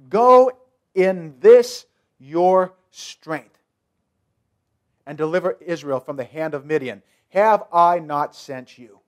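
voice of God. Acted. The Lord commands Gideon.

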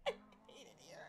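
hushed scared whisper. Real, not acted. Streamer hiding from the granny, terrified whisper. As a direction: breathe it out so she can't hear you.

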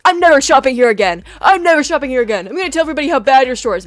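loud imitated complaint, voice pushed up; storming-out rant. Homeless man threatening bad word of mouth, mock-angry shout. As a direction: shout it like an outraged customer leaving.

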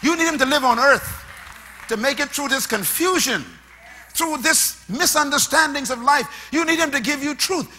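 loud, high pulpit appeal; full-force preaching. Acted. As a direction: preach it over the music.

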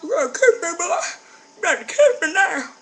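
high, loud put-on girl voice; mock demanding girl. Imitating a girl demanding a kiss, mock squeal. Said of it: "talking about".

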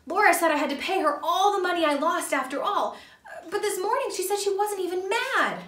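put-on high, loud kid voice with a nervous laugh; jittery young boy laughing between words; nervous Percy. A narrator's reading.

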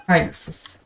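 a breathy aside, no voice; soft voiceless Saygısız; whispered insult. Real, not acted. Muttering insult at opponent.

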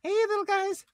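pet voice. Real, not acted. Greeting the hamsters in a squeaky high pet voice. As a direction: greet tiny animals sweetly.

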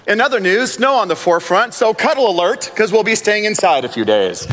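bright, bouncy newsreader voice; goofy news anchor. Retold or imitated. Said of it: "they sound so goofy sometimes 'cause they'll be like".

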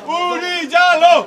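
langsam skandierter Ruf; Sprechchor. Real, not acted. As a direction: den Namen gedehnt in die Menge rufen. Protest chant at the scene. (langsam gerufener Protestruf, höher)

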